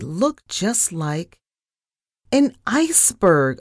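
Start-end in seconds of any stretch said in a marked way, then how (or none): 2.3-3.6 high, loud alarmed squeak; frightened squeak. A narrator's reading. Narrator spots an iceberg in front of the ship, squeaking. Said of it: "I squeaked".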